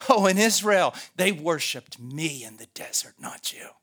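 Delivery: loud jeer, dropping as it goes; railing accuser. Acted. Accuser claims Israel worshiped him, railing. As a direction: taunt God as the accuser. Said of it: "a railing in their voice".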